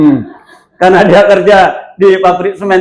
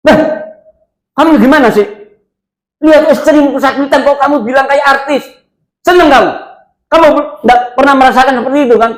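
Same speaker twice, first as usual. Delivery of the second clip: higher, sharp scolding of the husband; retold scolding; 9 semitones higher, faster than usual. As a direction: snap at the careless husband. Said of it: "Langsung tak sentak sama dia".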